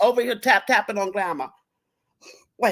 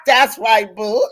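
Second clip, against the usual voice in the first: ordinary delivery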